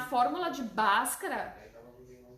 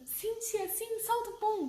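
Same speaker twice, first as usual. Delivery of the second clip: put-on high, eager child voice; imitating children; 9 semitones higher, slower than usual. Children answering teacher in eager high voice. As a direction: kids cheering her on.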